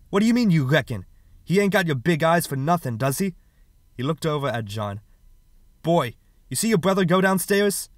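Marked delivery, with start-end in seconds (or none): 0.1-3.3 raised, louder, scornful questioning; angry challenge. Acted. Father challenging mother's excuse for John, angry. 5.8-7.9 loud, high-pitched angry demand; stern interrogation. Acted. Father turning on older son, angry interrogation. Father turns accusingly on John.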